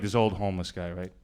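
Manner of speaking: ordinary talk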